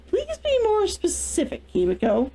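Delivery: high, lilting, feigned innocence; mock innocent. Kazunoha coolly deflecting her furious sister, mock innocent. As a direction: play dumb sweetly. Cool reply to angry sister.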